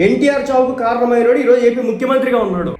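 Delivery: mocking the speaker's words; mocking outrage. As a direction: echo the quote back in disbelief. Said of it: "ఎంత పెద్ద మాట".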